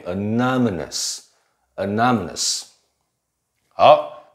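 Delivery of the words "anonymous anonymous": anonymous slowly, NON lifted and lengthened; weak uh, then long stressed NON in anonymous; stress moved later. Shown as a demonstration. Teacher shows the shifted stress, anonymous with NON drawn out. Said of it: "重音在后".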